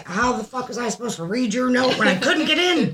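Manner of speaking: high-pitched exasperated rant; mock indignation. Acts out the exasperated child's reply.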